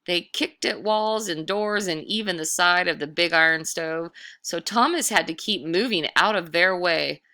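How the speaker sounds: ordinary delivery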